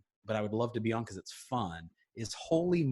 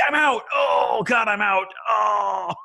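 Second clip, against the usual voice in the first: high, loud hammed-up yelp after tripping; mock panicked shout; 9 semitones higher, 9 dB louder, much slower than usual. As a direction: yell it as you fall on stage. Tripping on walk-up, imagined.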